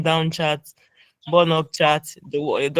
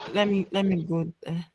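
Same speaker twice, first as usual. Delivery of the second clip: whispering; 6 dB quieter than usual. Real, not acted. Muttering to herself while searching.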